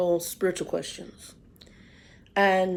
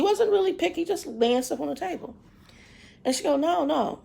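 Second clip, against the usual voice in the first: high, animated storytelling; excited retelling; 9 semitones higher than usual. Retold or imitated. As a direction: tell it with rising excitement. Retelling pastor's questioning, pitch soars.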